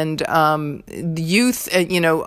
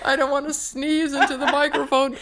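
laughing, high-pitched; 8 semitones higher than usual. Real, not acted. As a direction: giggle through the words. Laughing while fighting sneeze.

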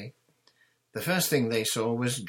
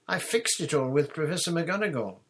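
high, loud, confident schoolgirl; lightly brushing off concern; breezy assurance; 8 semitones higher, 5 dB louder than usual. A narrator's reading. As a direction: reassure airily in a higher voice. Hermione first reassures Ron.

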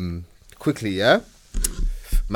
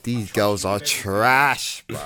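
slow disgusted verdict; 3 semitones lower, much slower than usual. Retold or imitated. His inner verdict, drawn out.

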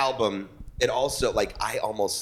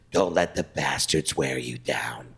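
low slow whispered advice; grandma whisper; 8 semitones lower, slower than usual. Retold or imitated. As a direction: whisper it like a wise old woman. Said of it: "She goes".

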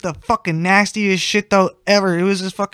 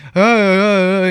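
dad calling out; 3 semitones higher, 7 dB louder, much slower than usual. Retold or imitated. Dad keeps calling through door.

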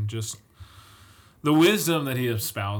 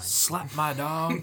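very slow, higher mock accusation; mock outrage; 4 semitones higher, much slower than usual. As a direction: stretch out the pretend grievance. Piling on mock accusations of betrayal.

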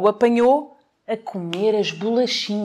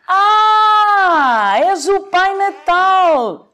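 slow, loud, high mocking wolf; 10 semitones higher, 5 dB louder, much slower than usual. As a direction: jeer at Santa, drawn out. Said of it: "disse o lobo em tom de troça".

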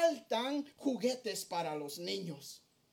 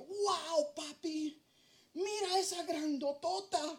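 put-on higher childlike voice, amazed; toddler daughter; 5 semitones higher, slower than usual. Father imitating his little daughter pointing out a big woman, higher and childlike. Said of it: "me dice".

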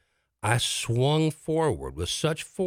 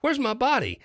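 alarmed realisation; 14 semitones higher than usual. Retold or imitated. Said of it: "I realize".

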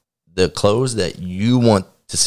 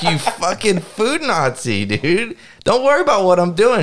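higher, mock-outraged retort; mock indignant yell; 11 semitones higher than usual. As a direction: play up the fake outrage at her. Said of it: "I'm like".

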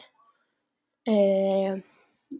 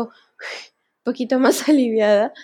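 relieved laugh; 7 dB louder, slower than usual. Real, not acted. Relieved after nearly crying.

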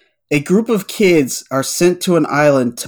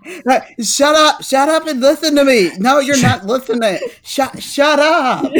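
mock bossy yell; 10 semitones higher than usual. Mock bossy rant while laughing.

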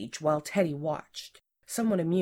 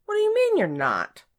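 high, loud, incredulous outburst; scandalized, pitch shooting up; outraged disbelief; 8 dB louder than usual. A narrator's reading. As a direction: burst out in exasperated disbelief. Boss's outraged reply to employee's denial.

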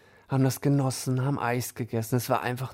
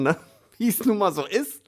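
ordinary talk, nothing marked

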